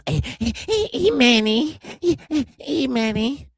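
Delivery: high sing-song "hey, Manny"; singsong teasing callout. Real, not acted. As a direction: call out to him playfully, way up high.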